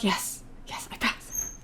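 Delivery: soft, voiceless, private triumph; whispered yes. Real, not acted. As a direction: whisper it to yourself in disbelief. Quiet joy after passing exam.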